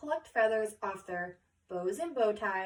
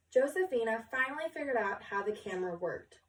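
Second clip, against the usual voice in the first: ordinary delivery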